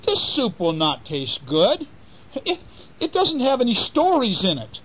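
a mouse bargaining for its life; clever mouse voice. Acted. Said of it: "said the mouse".

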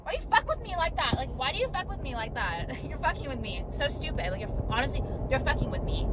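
half-laughing disbelief at the phone; exasperated complaining. Real, not acted.

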